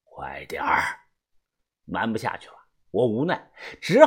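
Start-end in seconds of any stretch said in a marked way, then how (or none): 0.0-0.8 low, quiet insistence; hushed order. A narrator's reading. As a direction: press him without raising your voice. Boss presses for the gun. (低声催促)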